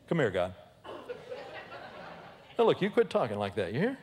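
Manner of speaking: lightly voiced cocky Peter, with laughs; a know-it-all advising God, half-laughing; bossy Peter. Peter lecturing God, comic.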